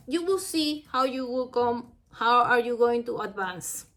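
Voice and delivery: ordinary talk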